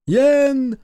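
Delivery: faraway answering yell; distant call. A narrator's reading. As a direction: shout across a distance. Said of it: "донеслось издалека".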